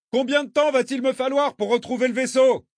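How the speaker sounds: very high, strained long-distance call. A narrator's reading. Traveller drifting away asks the girl, calling out. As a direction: throw the question across the water.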